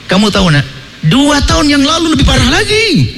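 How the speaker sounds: spiteful father. Acted. Spiteful father stirring up grudge.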